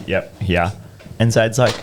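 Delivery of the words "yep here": low, monotone mumble. Retold or imitated. Service station worker serving a child, monotone. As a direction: answer in a dull, toneless low voice. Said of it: "just monotone".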